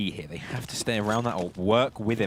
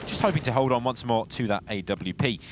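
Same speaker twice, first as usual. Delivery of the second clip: ordinary delivery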